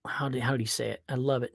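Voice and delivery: ordinary talk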